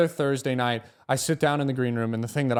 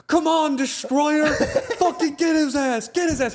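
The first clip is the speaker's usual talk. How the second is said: high, loud yelling at the robots, laughing; hammed-up TV scream; mock scream at the TV; 4 dB louder than usual. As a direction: cheer the robot on like a crazed fan. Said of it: "He's screaming at the T V".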